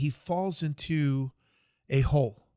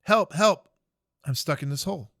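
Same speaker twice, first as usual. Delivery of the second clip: louder cry for help; 2 semitones higher, 7 dB louder than usual. Retold or imitated. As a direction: shout up from the hole, more desperate now. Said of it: "He starts screaming up again".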